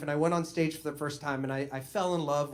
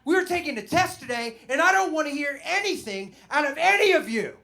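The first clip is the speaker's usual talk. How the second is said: loud, high bossy teacher voice; stern teacher; 13 semitones higher, 9 dB louder than usual. Retold or imitated. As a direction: bark it like a strict teacher facing a class. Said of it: "so he's like".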